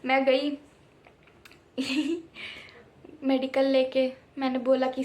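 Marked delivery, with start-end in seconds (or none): 1.8-2.6 soft laugh. Real, not acted. Laughs mid story.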